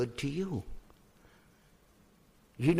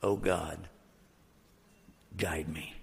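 low, hushed plea to God; slow, subdued supplication; retold humble prayer; 9 semitones lower, 7 dB quieter, slower than usual. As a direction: pray softly with head bowed to the ground. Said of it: "I said these words".

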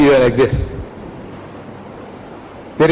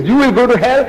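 higher-pitched retort, rising at the end; imitated opponent's retort; 8 semitones higher than usual. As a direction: throw it back as the other side, pitched up. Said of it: "He says".

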